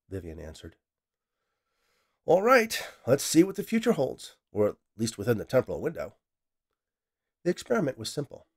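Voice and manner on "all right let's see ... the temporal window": much higher and louder, eager to begin; thrilled start. Acted. Scientist starts the time experiment, thrilled and loud. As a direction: launch the experiment with relish.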